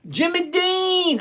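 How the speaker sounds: high, drawn-out holler of a name; exasperated sing-out to get attention; calling out. Real, not acted. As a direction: call the name out long and high. Calls out to a pestering viewer.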